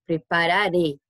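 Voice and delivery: "prepararé" with the last syllable "ré" hit and a closed e; stress on final é. Shown as a demonstration. Spanish teacher showing the stressed closed é, slow and pointed. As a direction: land hard on the closing "ré". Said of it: "qual é a sílaba forte".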